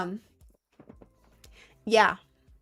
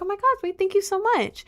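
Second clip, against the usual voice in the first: much higher, excited gratitude; gushing thanks; 11 semitones higher than usual. Retold or imitated. Thanking her best friend for help, high and excited. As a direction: gush with delight. Quoting her grateful reply.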